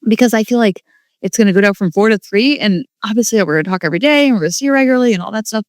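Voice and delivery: real tearful, high voice; choked up, talking through rising tears; tearing up. Real, not acted. Mom to viewers about her daughter leaving, tearful. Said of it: "I'm tearing up".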